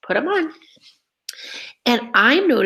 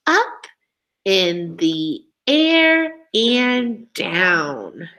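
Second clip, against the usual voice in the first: put-on very slow, rhythmic poem read for children; 3 semitones lower, much slower than usual. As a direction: read each line slowly with a swinging beat. Teacher reads the poem aloud.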